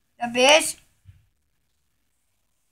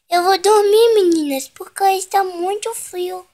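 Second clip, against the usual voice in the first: pet voice; 5 semitones higher than usual. Pretend. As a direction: talk as the pet, cosy and cute. Voicing the pet again.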